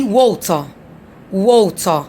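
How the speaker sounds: water said British, no R, woltə; British accent on water. Shown as a demonstration. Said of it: "British people don't stress on letter R".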